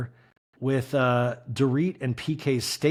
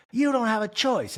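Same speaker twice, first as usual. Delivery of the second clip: higher, flat refusal to husband; mock high defiant wife; 9 semitones higher than usual. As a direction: shut him down in a high voice. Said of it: "Kyle's like".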